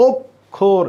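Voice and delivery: "অক্ষর" very slowly as "ok... khor"; broken syllables. Shown as a demonstration. Teacher showing the vowelless k, "অক্ষর" split slowly in two. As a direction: pause after "ok" in "অক্ষর". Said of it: "break করছি না".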